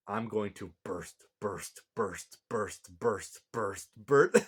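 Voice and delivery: low, odd chant of burst building at the end; a hint of a strange creature muttering burst; lightly voiced Anubis. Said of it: "Anubis says".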